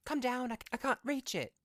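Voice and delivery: very high, loud put-on cry; desperate old woman. A narrator's reading. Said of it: "desperate and breathing heavily".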